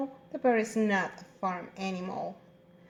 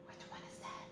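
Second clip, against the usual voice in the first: hushed, voiceless whisper; whispered question; 21 dB quieter, much faster than usual. Pretend. Teacher prompting pupils to name the animal, whispering.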